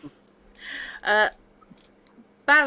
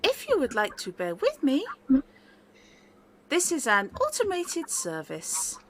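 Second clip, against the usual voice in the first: lightly robotic service voice, higher; automated phone voice; 5 semitones higher than usual. Acted. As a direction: read it like a polite automated line.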